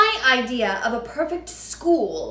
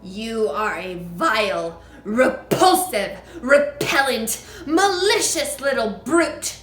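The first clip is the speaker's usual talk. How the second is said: shouting; 8 dB louder than usual. A narrator's reading. Trunchbull shouting insults at Matilda. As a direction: pound out each insult. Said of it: "the Trunchbull was shouting".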